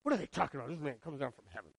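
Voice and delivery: grumbling under the breath; mock grumbling. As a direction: mutter it like a suspicious crowd. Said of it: "They say".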